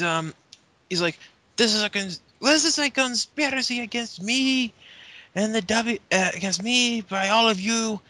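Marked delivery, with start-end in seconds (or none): 1.6-8.0 mock aggrieved protest. Imitating Del Rio's conspiracy complaint.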